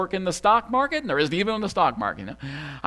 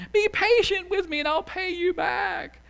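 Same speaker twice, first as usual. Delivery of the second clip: begging. Acted. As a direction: plead on your knees for more time. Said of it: "he begs him".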